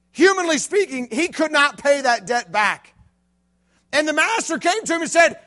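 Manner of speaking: fiery youth-pastor preaching; full-force preaching. Acted. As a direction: drive the story home to the crowd.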